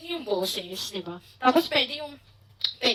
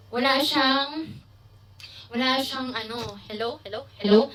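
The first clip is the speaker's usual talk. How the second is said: ordinary delivery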